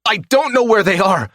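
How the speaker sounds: high, loud terrified squeal; squealing in fear. Acted. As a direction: squeal it out, panicked, at gunpoint. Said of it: "he squeals".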